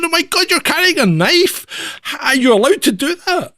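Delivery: high, shocked squeal of a tourist; alarmed American tourist. Retold or imitated. American woman alarmed, retold.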